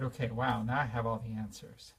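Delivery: normal talking voice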